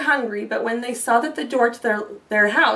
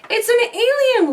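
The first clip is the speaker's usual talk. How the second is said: excited whisper; 10 semitones higher, 4 dB louder than usual. Acted. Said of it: "whispered excitedly".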